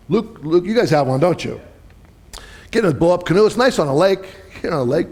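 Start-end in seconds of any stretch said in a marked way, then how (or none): none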